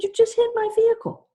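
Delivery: high, loud and fast accusation; retold scream. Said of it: "I screamed".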